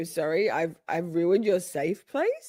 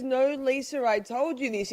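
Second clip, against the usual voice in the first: ordinary talk, nothing marked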